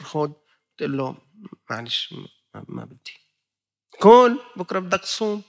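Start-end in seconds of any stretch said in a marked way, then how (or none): 1.7-3.2 whispered refusal. Retold or imitated. Declining the suhoor food. 4.0-5.4 higher and louder urging; insistent offer. Retold or imitated. Someone at the mosque pushing suhoor on him, loud urging.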